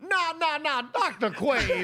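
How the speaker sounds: put-on Southern accent. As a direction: ham up a Southern doctor. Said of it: "I'm just a simple Southern doctor".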